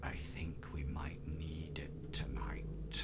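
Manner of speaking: very low, hushed guttural growl. Acted. Game master voices Ark addressing a player by the fire, low and guttural. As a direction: say it deep in the throat, almost whispered. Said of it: "very low, guttural voice".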